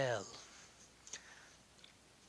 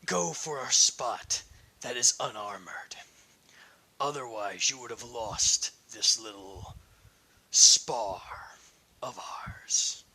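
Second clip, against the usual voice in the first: menacing whisper; 2 semitones lower, 4 dB louder than usual. Acted. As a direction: hiss it close to his ear as a warning. Whispered threat in a duel.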